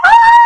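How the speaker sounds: very high, loud fangirl squeal; squealing shriek. Retold or imitated. Reacting to the lovers holding hands, squealing shriek. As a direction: let out a delighted shriek. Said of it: "I was like".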